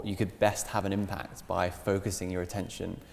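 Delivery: ordinary delivery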